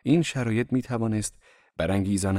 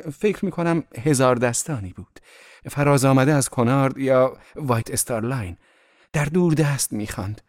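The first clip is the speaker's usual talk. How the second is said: higher, louder, lively romantic effusion; gushing; 5 semitones higher, 5 dB louder than usual. A narrator's reading. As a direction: rhapsodize brightly about the bird outside. Daisy gushes about the view. (با شور و صدای رساتر و زیرتر)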